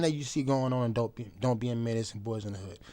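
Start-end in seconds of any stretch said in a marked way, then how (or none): none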